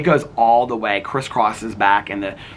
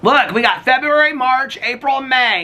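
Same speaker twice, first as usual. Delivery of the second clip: high, loud, hyped countdown called across; a high, loud countdown of the months; excited countdown; 13 semitones higher, 4 dB louder than usual. Real, not acted. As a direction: build up the anticipation. Calling out months to neighbor.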